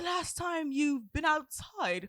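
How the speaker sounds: exaggeratedly gentle retelling; sweetly innocent retelling. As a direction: ask it slowly, all innocence. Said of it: "you did not say it like that".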